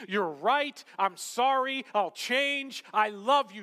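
preacher voicing a contrite heart; acted penitent response. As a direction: blurt out a repentant confession. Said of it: "our response will inevitably be".

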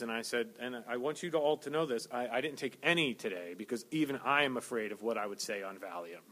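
low, soft, relaxed chat; soft conversational aside to the congregation; quiet casual aside. Acted. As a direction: drop into an offhand aside.

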